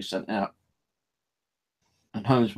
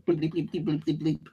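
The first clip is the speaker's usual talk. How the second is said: phone bleeps; 6 semitones higher, 3 dB quieter than usual. Retold or imitated.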